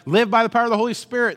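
fast, loud, high pulpit slogan; preacher's stock line. Retold or imitated. Pastor telling the crowd the slogan, fast and loud. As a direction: rattle off the slogan like a confident preacher. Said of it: "I told everybody".

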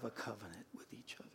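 hushed emphatic whisper. Acted. Pastor whispering his point to the congregation. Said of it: "intimate point in sermon".

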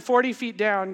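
normal talking voice

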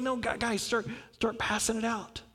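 hushed, urgent whisper to the disciples; Jesus whispers to his disciples to start handing out the food. Acted. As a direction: lean in and whisper the order. Jesus quietly prodding disciples.

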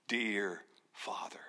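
hushed, intimate whisper to a loving father; tender whisper. Acted. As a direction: whisper it slowly, like a child to its dad. Tender name for God, Abba.

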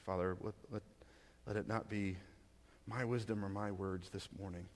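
hushed, reverent prayer to God; opening prayer. Acted. Pastor praying before reading Scripture, low and soft reverence.

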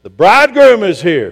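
loud, high call across a distance; hollering. Retold or imitated. Scott on the hill calling the bridesmaids, hollering. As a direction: holler it out like an announcement from a hilltop. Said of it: "he hollers out".

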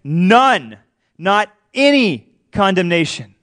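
"none" and "any" drawn out with swooping emphasis; emphatic stress. Retold or imitated. Preacher voicing Paul's point to the congregation, emphatic stress on "none" and "any". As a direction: hammer "none" and "any", slowly and apart. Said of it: "could not make it any more emphatic".

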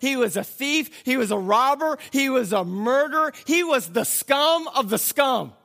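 high, loud, hammering each phrase; full-force preaching. Acted. Preacher listing a criminal's deeds to the congregation, fired-up preaching. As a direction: build the charges with emphatic force.

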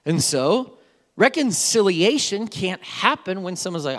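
ordinary talk, nothing marked

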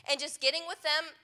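ordinary talk, nothing marked

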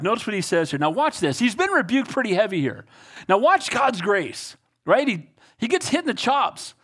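ordinary talk, nothing marked

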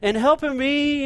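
butter her up like a dad wanting help; sweet-talking dad. Retold or imitated. Pastor imitating a dad sweet-talking his daughter, coaxing. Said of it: "a dad or a grandpa or somebody said".

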